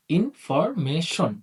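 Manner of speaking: ইনফরমেশন stretched very slowly into four syllables. Shown as a demonstration. Teacher to students, stretching ইনফরমেশন slowly by syllable. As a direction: drag ইনফরমেশন out slowly, one beat per syllable. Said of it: "এটাকে ভেঙে লিখি".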